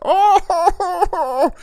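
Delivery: high, hammed-up bawling howl; mock violent crying. Imitating a grown man crying, violent wailing. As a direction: wail like a giant with a stubbed toe. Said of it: "It's vi-, very violent, too".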